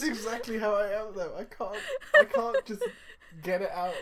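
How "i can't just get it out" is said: high, breathless wheezy laugh through the words; real helpless laughter, words squeezed out; wheezing laughter. Real, not acted. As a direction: laugh till you can barely get words out. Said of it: "It comes out as a wheeze".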